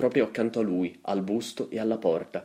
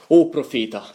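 loud, higher theatrical cry; declaimed, raised-voice address; impassioned invocation; 4 semitones higher, 10 dB louder than usual. A narrator's reading. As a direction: call out to the prophet with force. Anguished address to the raven.

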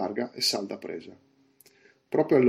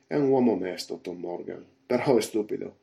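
usual speaking voice

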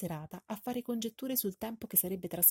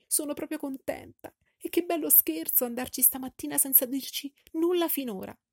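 much higher, gushing delight; 6 semitones higher than usual. A narrator's reading. Wife thanking her husband for the visit, high and gushing. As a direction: gush over him with delight. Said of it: "primo momento di esaltazione".

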